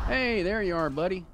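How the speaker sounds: high, loud fake-cheery hello; mock friendly greeting. Player mock-greeting the enemy, high and loud. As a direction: greet the monster like an old pal.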